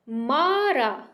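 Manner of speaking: very slow मारा with both long aa sounds stretched; मारा, आ खींचकर; drawn-out syllables. Shown as a demonstration. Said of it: "मा में और रा दोनों में आ की मात्रा है".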